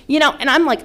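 ordinary talk

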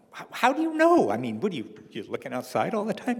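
jumps high on the first how, then settles; mock disbelief at her snooping; incredulous. Husband teasing his wife about watching the street, skeptical.

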